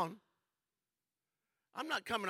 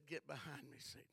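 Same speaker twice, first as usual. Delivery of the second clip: much lower and softer, calm and firm; quiet rebuke; 4 semitones lower, 15 dB quieter than usual. Acted. Jesus answering Satan again, soft and firm. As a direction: say it low, settled and certain.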